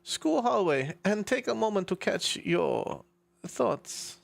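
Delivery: put-on slow, halting German-accented narration. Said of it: "right, German accent".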